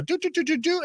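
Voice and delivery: high-pitched "do-do-do" typing sound; sing-song little key-tapping noises, high; imitated typing noise. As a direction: mimic busy typing with high vocal blips. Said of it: "I put that in and go".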